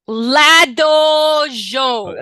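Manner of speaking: loud, very slow pirate tongue; shouted pirate phrase. Acted. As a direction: bellow the made-up pirate words. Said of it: "That is a pirate phrase".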